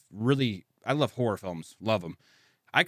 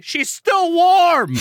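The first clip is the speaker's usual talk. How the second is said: very high, drawn-out loud cry; mock anguished wail; 8 dB louder, much slower than usual. Hero touching his murdered sister, hammed-up anguished cry.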